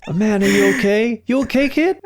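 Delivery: high-pitched voiced bystander; mock concerned passerby. Host acts a passerby checking on John, mock concern. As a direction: check on a kid in a put-on high voice. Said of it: "this guy just comes and he goes".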